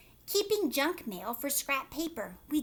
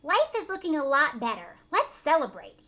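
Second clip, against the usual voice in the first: cheerful mayor; 3 semitones higher, 7 dB louder than usual. A narrator's reading. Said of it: "said the mayor".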